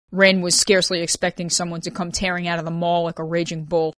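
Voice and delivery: plain reading voice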